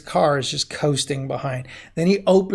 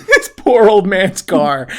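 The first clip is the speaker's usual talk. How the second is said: normal talking voice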